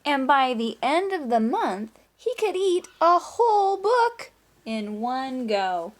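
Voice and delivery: put-on reading to children. A narrator's reading. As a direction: read it out to the class with rising excitement. Reading the story aloud.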